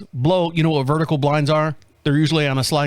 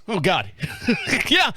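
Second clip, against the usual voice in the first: ordinary delivery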